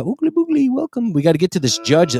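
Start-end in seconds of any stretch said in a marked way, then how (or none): 0.0-1.1 high playful oogly boogly; silly high catchphrase. Pretend. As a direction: toss it off in a goofy high voice.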